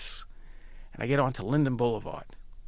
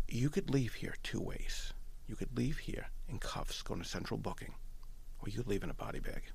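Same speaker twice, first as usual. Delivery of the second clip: quiet threat; 3 semitones lower, 10 dB quieter than usual. Retold or imitated. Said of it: "he was that pissed".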